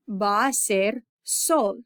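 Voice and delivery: very slow, each word laid out; slow model phrase. Shown as a demonstration. Teacher showing students the Spanish phrase, very slow. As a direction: spell it out for learners by ear. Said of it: "I would say".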